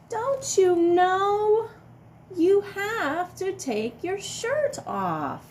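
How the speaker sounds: high, slow mock-exasperated question; singsong chiding. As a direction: tease the silly dinosaur. Scolding the silly dinosaur.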